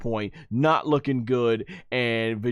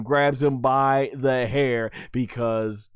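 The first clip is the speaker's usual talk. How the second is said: ordinary talk, nothing marked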